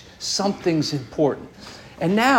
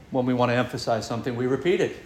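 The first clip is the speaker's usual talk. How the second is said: lower, softer and fast, as a repeat; quieter repeat; 5 semitones lower, 5 dB quieter, much faster than usual. Shown as a demonstration. Preacher repeats the point to the congregation, lower, softer and fast. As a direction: drop the voice and rush the echo.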